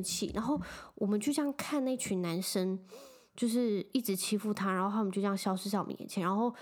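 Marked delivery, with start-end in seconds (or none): none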